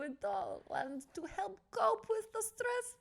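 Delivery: high, slow Count from Sesame Street voice; Count impression. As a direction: count like the vampire puppet. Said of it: "are you doing the Count from Sesame Street".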